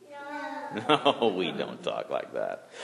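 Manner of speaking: normal talking voice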